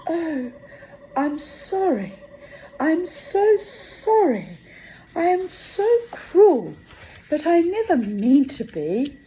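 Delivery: put-on high, pleading, remorseful girl's voice; contrite young girl. A narrator's reading. Death apologizes to the frozen old woman, high and pleading.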